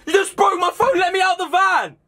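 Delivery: very high-pitched outraged yelling; frantic shouting. Acted. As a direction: yell desperately to be let out of the van. Phone broken, demands to be let out.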